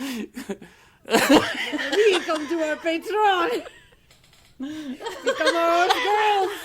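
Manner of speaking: slow, hammed-up Southern accent through laughter; laughing fake Southern accent, very slow. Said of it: "let me try that again in, in accent".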